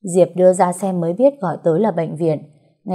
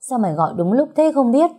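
high, quick grumble; grumbling; 7 semitones higher, faster than usual. A narrator's reading. Ngọc answers Diệp's phone call, grumbling. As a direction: complain in a raised pitch, fast. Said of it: "nó lào bào".

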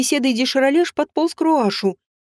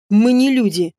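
ordinary delivery

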